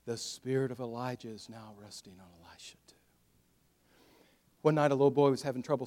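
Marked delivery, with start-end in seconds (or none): 0.1-3.0 low, very soft, breathy near-whisper; awed hush. Acted. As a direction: let it sink to a reverent whisper. Awestruck witnesses voiced in story.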